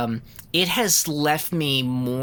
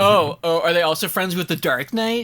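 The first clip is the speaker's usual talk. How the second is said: mock clueless voice; 5 semitones higher, 4 dB louder than usual. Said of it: "You're like".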